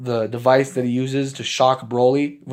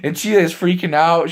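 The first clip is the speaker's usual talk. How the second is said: usual speaking voice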